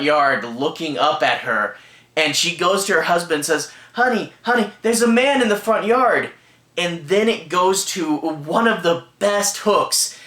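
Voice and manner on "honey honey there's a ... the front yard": higher mock-urgent wake-up call; retold alarm. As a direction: imitate a worried wife rousing her husband. Wife wakes husband about intruder.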